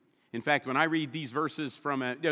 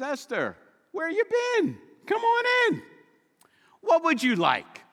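high, booming royal welcome; the king; 13 semitones higher, 4 dB louder than usual. Acted. King Xerxes welcoming Esther to his throne, hearty. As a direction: play a jovial king waving her in.

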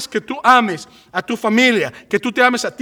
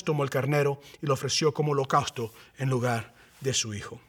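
low, soft solemn narration, sinking lower; hushed scripture reading; 6 semitones lower, 5 dB quieter than usual. A narrator's reading. Preacher reads Abraham's sacrifice to the congregation, hushed and solemn. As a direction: read it reverently, letting the voice drop away.

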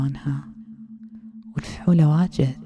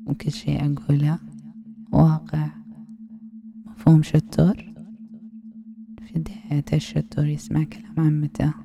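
ASMR whisper. Acted. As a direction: lull him in with a hushed hypnotic whisper.

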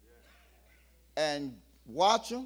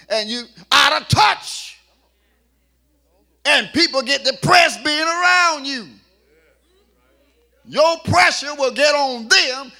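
higher and louder as it builds; rising sermon preaching; 5 semitones higher, 10 dB louder than usual. Acted. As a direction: preach it harder line by line. Preaching at full force.

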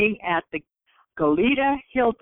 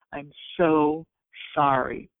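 slow, low, softened sympathy; hushed, heartfelt condolence with a pause; tender condolence; 4 semitones lower than usual. Retold or imitated. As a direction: console gently, pausing before the last word. Consoling grieving flood victims.

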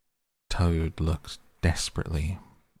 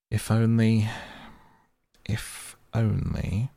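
higher, drawn-out hinting doubt; dubious; 5 semitones higher than usual. A narrator's reading. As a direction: leave the doubt hanging, slowing on the repeat. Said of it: "observed the rat dubiously".

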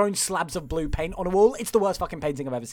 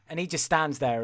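normal talking voice

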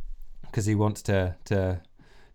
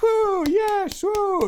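high whooping crowd cheer; 4 dB louder, much slower than usual. Acted.

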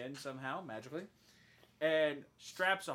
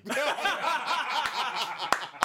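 big burst of high laughter; loud laughter; 8 semitones higher, 9 dB louder than usual. Real, not acted. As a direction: crack up loudly at a gag. Laughs at the fake credits gag.

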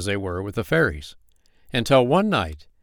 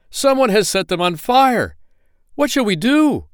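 much higher, loud frantic cries; panicked goblins; 13 semitones higher, 7 dB louder than usual. Acted. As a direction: shriek in alarm.

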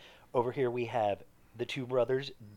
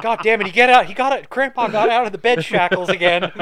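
much higher and louder alarm; mock panicked shout; 9 semitones higher, 12 dB louder than usual. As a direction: yell like the patient escaped.

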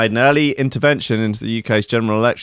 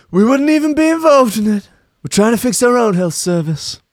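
much higher, louder Trump insistence; Trump impression; 10 semitones higher, 4 dB louder than usual. Trump answering Farage on the NHS, higher insistent voice. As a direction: deny it like Trump. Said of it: "Donald Trump replied".

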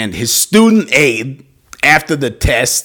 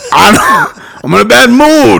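louder, higher, insisting on mood; loud insistence; 9 semitones higher, 5 dB louder than usual. Shown as a demonstration. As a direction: insist hard that you said mood. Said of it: "Mood or move?".

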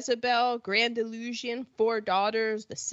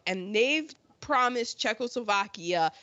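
normal talking voice

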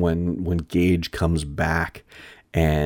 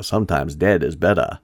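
put-on iconic line quoted in a Maine accent; haunting line in a Maine accent. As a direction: drop the R in a Maine accent, "better" as "bettah". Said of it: "sounds so haunting in that main accent".

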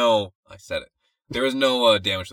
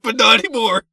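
ordinary delivery

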